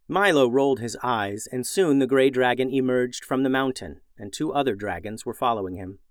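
ordinary delivery

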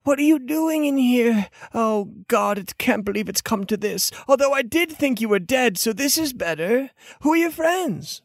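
high, fussy-friendly character voice; cleric character. Acted. Cleric greeting a long-lost friend in the dungeon, surprised. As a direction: start high, settle, then lift on the question. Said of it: "He goes".

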